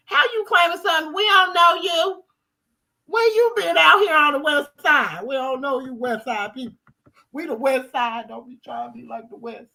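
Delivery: heated West Side callout; indignant. Real, not acted. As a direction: call them out hard. Scolding fake West Side claimers.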